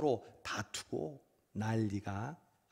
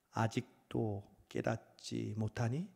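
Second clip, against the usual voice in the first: soft, slow, tender asking; gentle question; 4 semitones lower, 5 dB quieter, slower than usual. Acted. Jesus asking us today, soft tender question. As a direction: ask it gently, like a loving father. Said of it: "저와 여러분에게".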